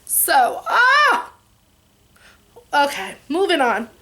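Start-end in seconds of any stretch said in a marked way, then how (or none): 0.7-1.3 high-pitched ah, overcome; a sudden high cry of feeling; high emotional cry. Real, not acted. Vlogger overcome talking about her dad, high cry. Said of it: "I get very emotional".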